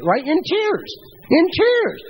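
rising insistence on "tears"; emphatic repeat. Real, not acted. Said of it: "I'm on the phone ... in tears".

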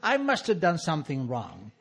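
a lightly voiced father, fake-sincere; hint of a wheedling father apologizing; father's letter voice. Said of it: "he continues".

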